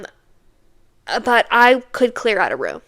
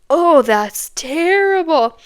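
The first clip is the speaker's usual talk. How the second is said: disgusted reaction; 6 semitones higher, 6 dB louder, slower than usual. Retold or imitated. Date reacts to the smell.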